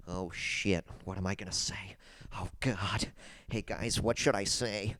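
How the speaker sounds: hushed, low mock muttering; annoying whisper. Host mocking Max for checking his journal, annoying hushed whisper. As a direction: imitate someone whispering to themselves. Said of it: "What else can I whisper annoyingly".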